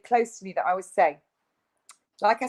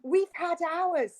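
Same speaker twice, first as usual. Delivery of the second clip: mocking neighbour; 8 semitones higher, 3 dB louder, slower than usual. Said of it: "She went".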